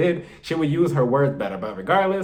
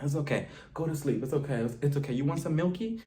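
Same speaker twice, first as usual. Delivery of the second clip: put-on softer, hushed voice like soothing a crying baby; mock baby soothing; 6 dB quieter than usual. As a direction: coo at her like she's an infant. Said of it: "holding Ramona like a baby".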